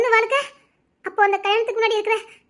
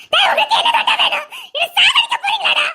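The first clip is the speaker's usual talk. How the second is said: anguished shout; 12 semitones higher than usual. Acted. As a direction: scream it out in despair. Breaking down at him. (வேதனையுடன் கத்தல்)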